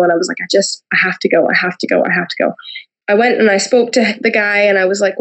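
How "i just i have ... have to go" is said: mildly overwhelmed, repeating it under the breath; retold overwhelmed plea. Guest retells being called to go at church, understated overwhelmed plea. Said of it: "couldn't get myself under control".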